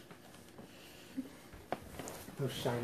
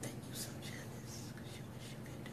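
whispering; 12 dB quieter than usual. Real, not acted. Teasing aside to co-host.

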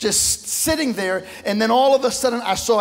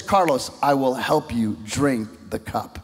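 tender voice of the Lord; 8 semitones lower than usual. Retold or imitated. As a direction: sink the voice down low and gentle. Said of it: "I heard the Lord say this".

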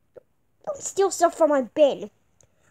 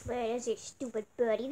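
normal talking voice

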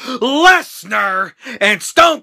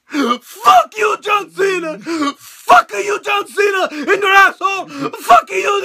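higher, louder, near-crying yell of rage; mock furious Rusev rant; 9 semitones higher, 4 dB louder than usual. Retelling Rusev's promo to the co-host: hammed-up furious yell. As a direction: rant at Cena like your career was stolen. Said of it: "Dude, he was so mad!".

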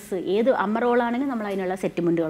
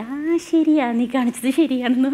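lightly voiced older colleague, raised and approving; Sheelamma, delighted; 3 semitones higher, 4 dB louder than usual. Sheelamma approving an imitation of herself, lightly voiced delight. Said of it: "ഷീലാമ്മയ്ക്ക് ഒരു ദിവസം കാണിച്ചു കൊടുത്തപ്പോൾ ഭയങ്കര ഇഷ്ടമായി".

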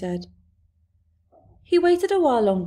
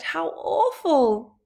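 higher, slow and drawn out; horrified cry; 7 semitones higher, 4 dB louder, much slower than usual. A narrator's reading. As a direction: let the horror stretch the words. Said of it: "Sophie cried".

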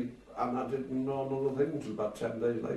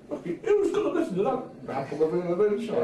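higher scornful voiced boss; mocking the boss; 11 semitones higher, 3 dB louder than usual. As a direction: sneer at the idea, high up. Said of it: "He said".

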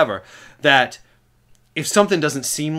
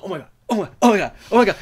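imitated amazed geek; 7 semitones higher, 5 dB louder, much faster than usual. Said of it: "He's like".

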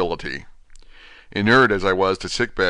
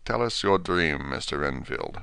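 quiet, grave; 3 semitones lower, 5 dB quieter than usual. Acted. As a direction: ask it gently and gravely, slowing down. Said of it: "in a quiet, grave voice".

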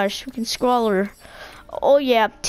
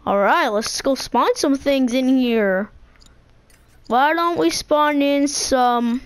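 ordinary talk

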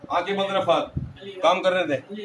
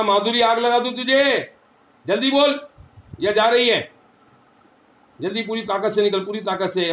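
higher, louder angry shouting; furious threat; 4 semitones higher, 4 dB louder than usual. Real, not acted. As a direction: threaten to burn it, yelling. Healer shouting at possessing spirit.

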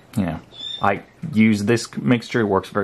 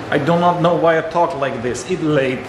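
a hint of a Slovenian accent, higher; fading mock Melania voice; lightly voiced Melania impression; 6 semitones higher than usual. Vlogger half-keeps the Melania voice while explaining.

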